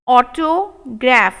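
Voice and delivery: autograph slowed right down; slow model take. Shown as a demonstration. Teacher modelling autograph slowly for students. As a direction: sound it out slowly. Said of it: "slow model take".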